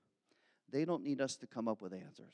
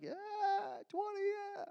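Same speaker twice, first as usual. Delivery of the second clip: much higher, offhand and breezy; carefree younger self; 3 dB quieter than usual. Retold or imitated. Pastor mimicking his twenty-year-old self, breezy and high. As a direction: toss it off like time is endless. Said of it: "I was like".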